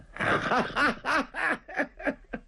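high, airy laugh; light mocking laugh. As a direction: laugh at the fool, amused. Rescuer laughs at Zhang Fang.

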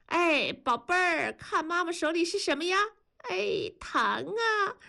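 put-on high, sing-song baby talk; playful high-pitched mommy voice; mother coaxing baby. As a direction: dangle a treat before a baby, drawing words out. Mother tempts baby with candy.

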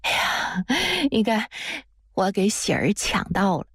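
light acted chuckle into the words; granny happily laughing as she announces her win. A narrator's reading. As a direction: say it through a small, pleased laugh. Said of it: "马奶奶还笑着说".

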